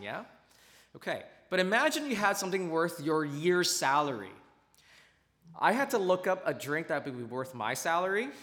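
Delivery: usual speaking voice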